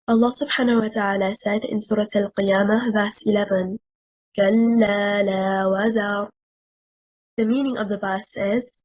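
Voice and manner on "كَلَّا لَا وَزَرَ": fast melodic recitation of كَلَّا لَا وَزَرَ; Quran recitation. Acted. Teacher quoting a verse in recitation style. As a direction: recite it as in the mushaf, quickly.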